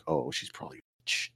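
imitating a secret suspicion in a whisper; hushed, unvoiced aside to yourself; whispered private thought. Recalling his thought at a chick's house, whispered.

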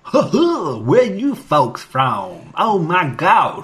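hammed-up Alabama tourist, loud and drawn out; Alabama accent. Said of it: "Like he's from Alabama".

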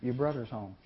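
1 grumbled quietly. Retold or imitated. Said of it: "Wait a minute, I misunderstood".

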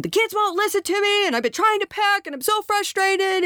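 husband complaining to wife about the kids and packing, mock exasperated; frustrated husband. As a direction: vent like a fed-up husband coming in the door. Husband coming home, venting frustration.

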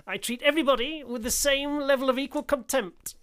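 put-on loud, high-pitched character accent; forceful accent impression, pitched well up; Mackay's accent. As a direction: declaim it in a put-on prison officer's accent. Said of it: "I've got his accent back now".

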